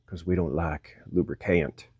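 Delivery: put-on low, soft Southern accent. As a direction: toss it off as a Southern-accented aside. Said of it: "I did a southern accent".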